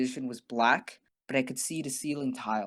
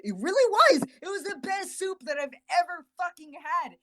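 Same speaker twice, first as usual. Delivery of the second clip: excited raving; 12 semitones higher than usual. Real, not acted. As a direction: rave about the soup like it was heaven. Raving about hospital soup.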